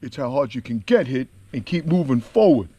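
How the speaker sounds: slurred movie-boxer impression; Rocky. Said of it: "he talks like this".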